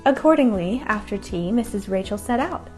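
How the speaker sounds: usual speaking voice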